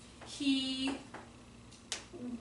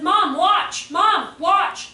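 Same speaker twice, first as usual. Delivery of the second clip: put-on kid calling out; 5 semitones higher, 9 dB louder, much slower than usual. Child calling mom at pool.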